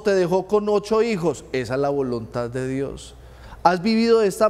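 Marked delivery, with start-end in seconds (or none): 1.5-3.1 lower, soft, resigned sigh of fatalism; resigned refrain. Retold or imitated. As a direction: drop the voice like a shrugging believer.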